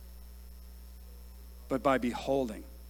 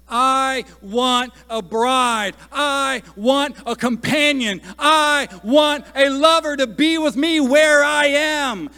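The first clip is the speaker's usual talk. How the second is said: a higher, louder impassioned cry, slowing down; 9 semitones higher, 9 dB louder, slower than usual. Retold or imitated. Preacher voicing Jesus's prayer to the Father, an impassioned cry. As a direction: cry it out like a longing plea. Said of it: "And he's crying out".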